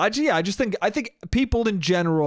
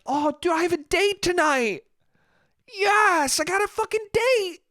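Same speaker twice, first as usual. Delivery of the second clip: high, thrilled squeal about a date; excited younger self. Retold or imitated. Younger self hyped about a date, high excited squeal. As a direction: gush like a kid with a date tonight. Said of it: "I used to be like".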